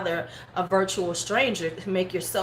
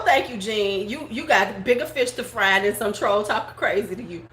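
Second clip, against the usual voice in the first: usual speaking voice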